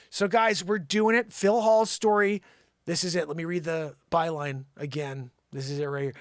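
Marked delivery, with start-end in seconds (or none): none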